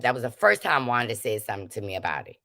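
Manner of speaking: normal talking voice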